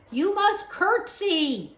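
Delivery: slow, high, loud order; shouting. A narrator's reading. Louise shouting slowly at deaf Mig. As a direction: bark the rule slowly. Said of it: "shouted Louise".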